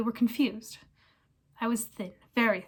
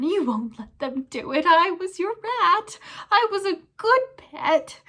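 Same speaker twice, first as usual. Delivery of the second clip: slow, high, loud squeaky whine; whimpering plea; 8 semitones higher, 6 dB louder than usual. Acted. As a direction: beg like a pet rat. Pettigrew begs Ron.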